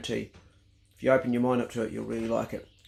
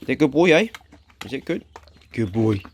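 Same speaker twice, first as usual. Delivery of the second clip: praising dog; 4 dB louder than usual. Real, not acted. Praising dog while eating.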